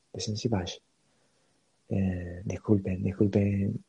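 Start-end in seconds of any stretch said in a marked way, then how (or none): 0.1-0.7 "Batch" con "sh" suave final, como "bash"; pronunciación dudosa. Shown as a demonstration. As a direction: probar "Batch" acabado en "sh", más bajito y cayendo. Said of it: "la pronunciación". ("Batch" pronunciado "bash", en voz más baja)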